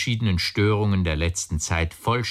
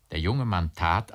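ordinary delivery

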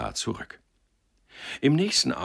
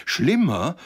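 loud, high, aghast question; breathless echo; 10 semitones higher, 7 dB louder than usual. A narrator's reading. Listeners repeating Josef's question, breathlessly aghast. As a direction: echo it louder in shock. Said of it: "wiederholten die anderen atemlos".

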